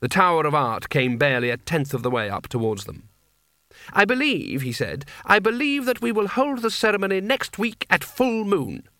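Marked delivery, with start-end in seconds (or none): 3.9-4.6 high, declaring voice; commanding young master. A narrator's reading. Coin announces his decision. 5.2-8.9 high, sustained proclamation; commanding young master. A narrator's reading. Coin announces his decision.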